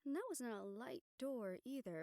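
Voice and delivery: ordinary delivery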